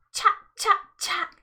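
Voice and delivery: high, sharp bird cry chack chack; high squawking chack; jackdaw calls. A narrator's reading. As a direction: imitate jackdaws calling.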